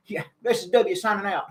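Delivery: put-on folksy Texan president talking to the kids; George W. Bush impression. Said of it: "impersonation of George W. Bush".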